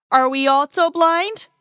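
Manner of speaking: in anger. A narrator's reading. Pharisees confronting Jesus, slow loud angry challenge. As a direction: fling the question back, furious and loud. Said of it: "said the Pharisees in anger".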